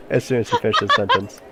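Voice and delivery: usual speaking voice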